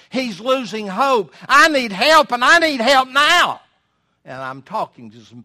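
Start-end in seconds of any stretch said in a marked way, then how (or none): 1.5-3.6 loud, high cry of a despairing father; desperate cry. Acted. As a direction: cry out for help as the desperate dad. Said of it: "Cried means there is a sense of desperation".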